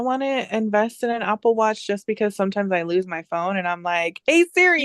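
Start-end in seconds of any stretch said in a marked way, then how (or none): 4.3-4.9 higher, louder call to a voice assistant. Retold or imitated. Phone owner hailing Siri for a lost phone, calling out.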